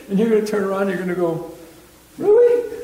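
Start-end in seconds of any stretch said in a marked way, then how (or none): 2.2-2.7 very high, loud squeal of surprise; astonished disbelief. Acted. Believer turning round at God's praise, squeaky loud astonishment. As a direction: act out incredulous delight.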